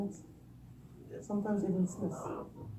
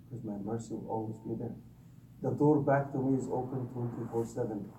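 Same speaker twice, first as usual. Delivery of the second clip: tender reassurance; 7 semitones lower, 10 dB quieter than usual. Pretend. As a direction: lower and much softer, gentle promise of mercy. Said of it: "As if Allah is saying".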